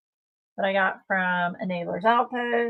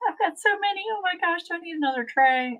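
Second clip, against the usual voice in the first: 1 high dithering inner voice; 12 semitones higher than usual. Retold or imitated. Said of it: "I was like".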